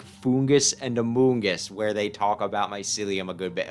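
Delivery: ordinary talk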